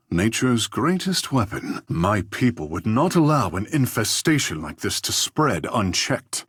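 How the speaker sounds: ordinary delivery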